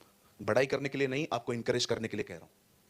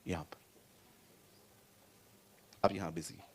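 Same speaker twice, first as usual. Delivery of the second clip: hushed aside; 8 semitones lower, 12 dB quieter than usual. Real, not acted. Points to phone, hushed.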